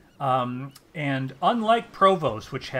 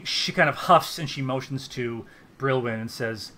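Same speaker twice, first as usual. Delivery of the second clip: ordinary talk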